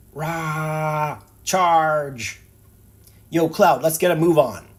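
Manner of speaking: Barret battle cry. Acted. Barret charging in.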